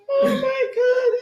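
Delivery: high, loud shriek of disbelief; a laughing scream of shock; shocked cry. Real, not acted. Shrieks after laughing fit.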